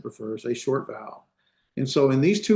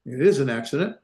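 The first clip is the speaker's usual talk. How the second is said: usual speaking voice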